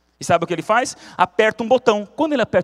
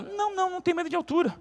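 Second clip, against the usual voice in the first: high nervous denial; 13 semitones higher, 6 dB quieter, faster than usual. Acted. Frightened woman denies fear.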